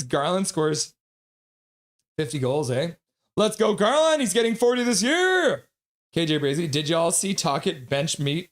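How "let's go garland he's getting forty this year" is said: high, loud rallying fan cry; excited cheer. Real, not acted. Host cheering Garland on to forty, excited. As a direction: cheer the player on with soaring pitch.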